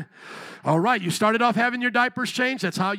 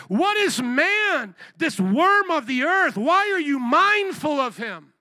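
slow, higher, louder cry of wonder; psalmist's cry; 7 semitones higher, 5 dB louder, slower than usual. Acted. Psalmist asking God about man, slow loud wondering cry. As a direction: proclaim it like an amazed psalmist. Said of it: "the psalmist is saying".

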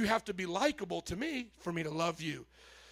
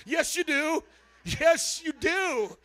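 higher, louder insistence; firm insistence; 6 semitones higher, 4 dB louder, slower than usual. Real, not acted. Pastor insists to the congregation, higher and louder. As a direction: press it home, higher and louder.

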